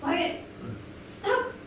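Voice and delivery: high, curt shushing; acted telling-off to keep quiet; shushing disciple. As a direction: hush someone who is too noisy. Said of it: "the disciple".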